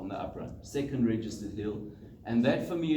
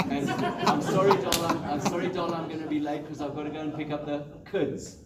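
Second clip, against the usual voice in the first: mock apology; 4 semitones higher than usual. Runner apologises to his wife on the phone, hammed-up. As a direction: beg forgiveness in a hammed-up whine.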